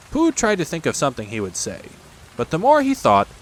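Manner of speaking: ordinary delivery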